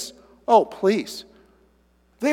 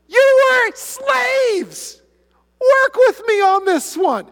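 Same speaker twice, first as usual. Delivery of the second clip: high, drawn-out disbelief; preacher exasperated with the Israelites; 7 dB louder, much slower than usual. Real, not acted. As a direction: stretch it out in disbelief. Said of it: "exasperated outburst".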